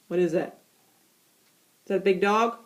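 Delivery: ordinary delivery, nothing marked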